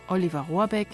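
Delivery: usual speaking voice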